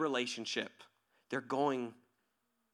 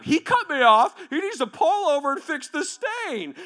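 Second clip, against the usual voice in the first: high, loud outraged complaint; mock indignation; 8 semitones higher, 10 dB louder than usual. Wronged driver demanding the other pull over, loud indignation. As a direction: rant like the wronged party, voice pitched up.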